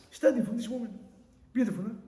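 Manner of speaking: normal talking voice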